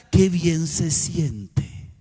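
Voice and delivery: very slow, drawn-out and dreamy; qué bien se siente, stretched slow and dreamy; savoring slowly. Acted. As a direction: let each word linger with relief. Savoring the feeling after forgiving.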